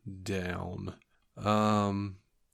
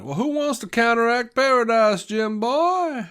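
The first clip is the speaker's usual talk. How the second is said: put-on Southern accent; 12 semitones higher, 4 dB louder than usual. Imitating McCoy's Southern accent to a co-host. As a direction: play the folksy Southern country doctor. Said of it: "just like a real Southern accent".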